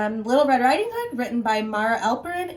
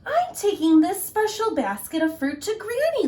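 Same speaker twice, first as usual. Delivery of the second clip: put-on high, loud little girl; sweet innocent girl; 10 semitones higher, 4 dB louder than usual. Little Red voiced.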